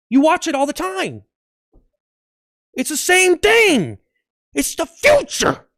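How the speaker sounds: very high-pitched whiny outrage; hammed-up protest, line ends held high; indignant screech. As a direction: squeal in exasperated defence. Acted indignant rant at critics.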